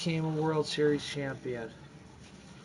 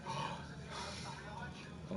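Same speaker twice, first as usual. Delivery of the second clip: sharp breathless imitated gasp; mock collective gasp; crowd gasp; 10 semitones higher, 14 dB quieter than usual. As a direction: gasp like a shocked stadium. Crowd reacting in retold scene.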